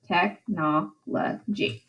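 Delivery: "Technology" slow and soft, tech-no-lo-gy; four separate syllables. Shown as a demonstration. Teacher showing four syllables, slowly spaced "Technology". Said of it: "it has four syllables".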